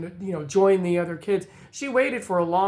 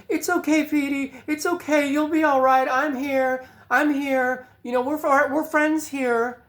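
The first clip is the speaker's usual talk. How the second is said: put-on much higher, soothing little girl voice; concerned little girl; 7 semitones higher than usual. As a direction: comfort him like a caring kid. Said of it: "she was very, very concerned".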